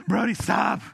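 panting as if winded from running; mock out of breath. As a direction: gasp the words out mid-chase. Winded runner catches up to son.

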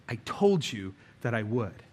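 low, hushed, steady dad reply; low quiet father. Retold or imitated. Father holding his rescued daughter, low and hushed.

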